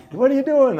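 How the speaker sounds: high, loud acted inquiry; mock eager question. Townsperson quizzing the returned priest, loud high mock eagerness. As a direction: ask it loudly, playing the greeter.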